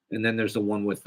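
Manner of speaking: normal talking voice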